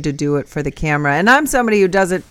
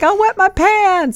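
pitched up and dragged out in alarm; high, slow panicked squeal; slower than usual. Retold or imitated. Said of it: "I'm like".

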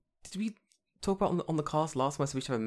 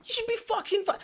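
indignant squeak. Real, not acted. Indignant outburst at the ruling.

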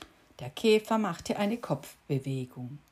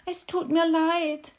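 higher, louder sorry; apologetic cricket; 11 semitones higher, 6 dB louder than usual. A narrator's reading. Cricket apologizing to the beetle, higher and earnest. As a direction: say sorry wide-eyed and earnest.

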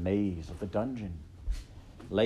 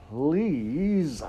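long, loud, raised please; exasperated plea; 7 semitones higher, 7 dB louder than usual. A narrator's reading. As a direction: stretch it out in exasperation. Rat pleads with shouting girl.

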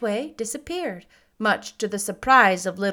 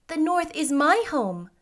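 put-on higher-pitched old lady voice; old lady witch; 7 semitones higher than usual. A narrator's reading. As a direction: speak as a kindly witch, pitched up.

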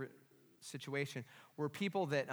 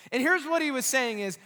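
loud, high, driving sermon delivery; raised-voice pulpit storytelling; full-force preaching; 8 semitones higher, 9 dB louder than usual. Real, not acted.

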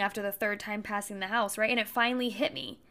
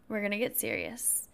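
ordinary delivery

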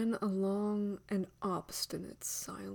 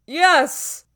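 loud, higher answering shout; forceful raised-voice reply; shouted echo; 9 semitones higher, 17 dB louder than usual. A narrator's reading. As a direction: throw it back at full voice.

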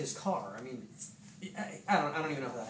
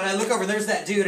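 normal talking voice

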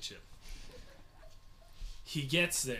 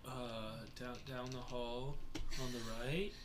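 quiet stammer; 4 semitones lower, 11 dB quieter than usual. Retold or imitated. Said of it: "Mateo's like".

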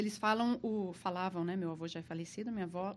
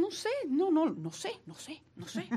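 flustered denial; 7 semitones higher, much faster than usual. Retold or imitated. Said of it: "Ele falou assim".

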